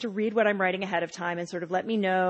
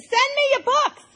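mock yell; 14 semitones higher, 3 dB louder than usual. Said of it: "she yells over your shoulder".

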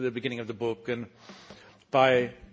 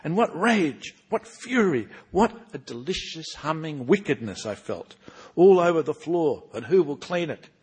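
louder, animated first-person reading as Parrot; story narration; 4 semitones higher, 13 dB louder than usual. Acted. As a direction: tell it with relish, as the old storyteller Parrot. Author reads Parrot's chapter.

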